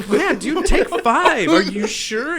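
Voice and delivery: high, loud, eager to please. Retold or imitated. The driver eagerly hands the stoner cash, high and loud. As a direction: hurry to hand over money, voice up high.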